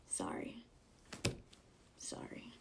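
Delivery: tiny whispered apology; whispered mock apology. Answering the rude moviegoer before taking it back, mock-apologetic whisper. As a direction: whisper it, not meaning it.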